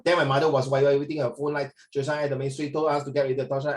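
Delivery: fast imitation of a student rushing the line; rushed mock reading. Shown as a demonstration. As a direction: gabble it like a hurried student. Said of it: "If you are".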